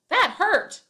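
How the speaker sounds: "That hurt" yelled high and loud, tag plain; right version: "That hurt" shouted; yelling the quote. Shown as a demonstration. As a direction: shout the spoken words, then say the tag calmly. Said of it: "Or is it".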